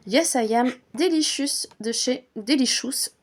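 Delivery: English perfume name said with a strong French accent. Real, not acted. Naming the perfume in English, heavy French accent. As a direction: read "Yes, I Am Delicious" the French way, "deliʃys". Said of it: "Mille excuses pour mon accent, euh, anglais".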